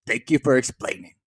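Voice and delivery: low-key, badly done wrestler impression; poor imitation of a wrestler's voice; bad Nick Gage. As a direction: attempt his voice and don't quite get it. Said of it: "that was a bad Nick Gage voice".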